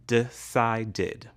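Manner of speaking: "Decided" broken into de-ci-ded; three syllables shown. Shown as a demonstration. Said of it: "has three syllables".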